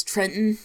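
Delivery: TRENTON with the second T swallowed; dropped middle T. Shown as a demonstration. Naming the New Jersey capital, middle T dropped. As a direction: say "Tren'n", no middle T. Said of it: "we don't pronounce T's in the middles".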